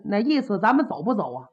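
usual speaking voice

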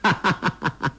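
acted amused chuckle in a run of short bursts; villain's laugh. A narrator's reading. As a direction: laugh at a trick seen through. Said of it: "他笑道".

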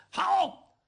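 high cheer of bravo. Acted. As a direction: cheer from the crowd. 看热闹的小伙跟着吼好. (高声叫好)

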